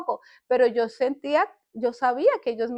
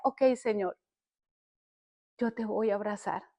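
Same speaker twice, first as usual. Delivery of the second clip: soft, yielding retold prayer; surrendering prayer; 3 semitones lower, 8 dB quieter than usual. She tells the Lord she will embrace him, softly. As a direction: quietly give in, lower at the end.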